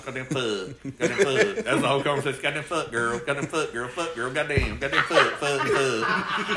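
laughing Tank imitation; half-laughing mock of Tank; Tank impression. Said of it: "Tank sometimes".

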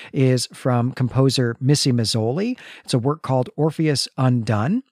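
normal talking voice

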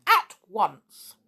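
loud, sharp demand; angry order. A narrator's reading. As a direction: finish the order with force. Goblin's order in quarrel continues.

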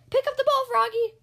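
high, loud urgent yell. A narrator's reading. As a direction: order him across the field. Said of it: "yelled the coach".

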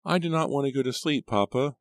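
put-on high pleading child voice; little girl voice. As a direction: beg like a small girl at bedtime.

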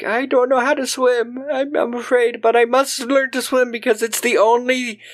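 high, mock fearful; nerdy mock fret; mock nervous Chris. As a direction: Chris fretting about swimming. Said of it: "He's like".

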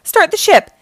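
high, loud order; urgent yell. A narrator's reading. Leo orders a getaway, urgent yell. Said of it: "yelled Leo".

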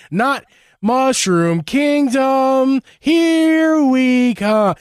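put-on slow, high Brooklyn accent. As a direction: stretch each word in a Brooklyn accent. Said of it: "let's do a Brooklyn".

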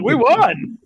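very high, loud victory cry; freaking out. Retold or imitated. Friend breaking news of the win on FaceTime, freaking out. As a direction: squeal the news as an overjoyed friend. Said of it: "freaking out".

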